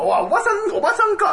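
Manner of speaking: comic shocked "oh, aunt!"; mock realization. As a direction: stammer it out in sudden understanding. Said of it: "って言ったら".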